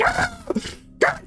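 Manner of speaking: shrill high yelp then a gasped God; panicked yelp. Retold or imitated. Matthew shrieking as the gun fails, panicked high yelp. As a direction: squeal it in terror.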